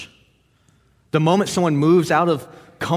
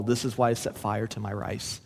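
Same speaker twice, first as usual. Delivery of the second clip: softer, lower, gently explaining; gentle; 4 semitones lower, 7 dB quieter than usual. A narrator's reading. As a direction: lay it down gently, like a kind old man. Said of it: "gently, he said to them all".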